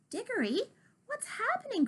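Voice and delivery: high alarmed cry. Acted. Kitty crying out to Diggory, high alarmed call. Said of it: "cried Kitty".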